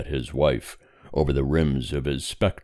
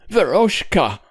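high, louder, upset calling; tearful call; 6 dB louder than usual. A narrator's reading. Mother beginning to plead with daughter, tearful call. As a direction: call her name on the edge of sobs. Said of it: "but sobbing she grew silent".

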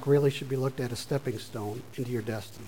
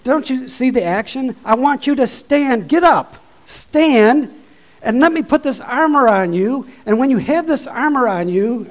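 very loud, much higher exhortation; shouted preaching; 8 semitones higher, 8 dB louder than usual. Acted. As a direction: shout them to their feet.

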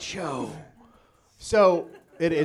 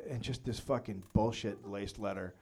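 normal talking voice

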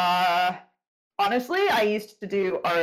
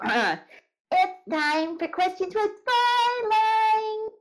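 high, drawn-out announcer call; segment announcement; 11 semitones higher, much slower than usual. Pretend. Host has to announce the questions segment, hammy announcer call. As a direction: stretch it out like a show intro, pitched way up.

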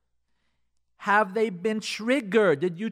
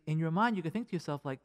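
ordinary talk

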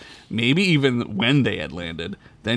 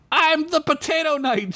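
high proud announcement; proudly. Acted. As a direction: puff up and declare it. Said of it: "she said proudly".

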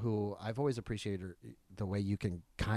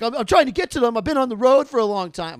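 loud, much higher, frantic excuse; lying; 12 semitones higher, 15 dB louder than usual. Retold or imitated. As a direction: scramble to sell the lie. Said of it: "I tried to lie".

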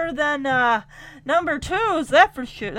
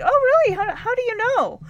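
much higher, lightly voiced sweet young woman; Betty's sweet reply; 9 semitones higher than usual. Betty asking the rival, high and innocent.

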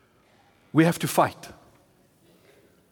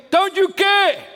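frantic plea; 10 semitones higher, 4 dB louder, slower than usual. Retold or imitated. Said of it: "they wake him up, they say".

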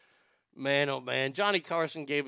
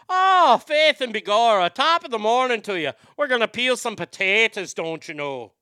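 loud, high, hammed-up Irish brogue; Irish accent; 6 semitones higher, 8 dB louder than usual. Shown as a demonstration. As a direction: burst into a stage-Irish accent. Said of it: "with Irish, you start with Faith and Begor".

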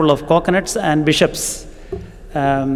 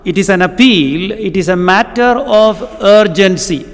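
higher, slower, emphatic preaching on unity; rising preaching fervour; 4 semitones higher, slower than usual. Acted. Preacher urging the Ephesian church to unity, fervent. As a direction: press each phrase like an urgent appeal. Preacher builds urgent appeal.

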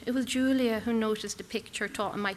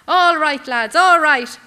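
loud call to the lads over the barricade; 6 semitones higher, 16 dB louder, slower than usual. Acted. Narrator calling out to lads behind sandbags, loud. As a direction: shout it out to calm the men.

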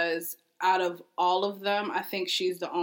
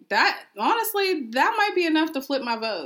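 excited; 9 semitones higher than usual. Real, not acted. Excited change of mind.

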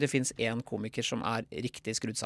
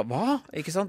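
ordinary talk

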